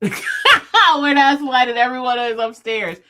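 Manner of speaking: slow, laughing through the words; giggling, spooked and amused. Real, not acted.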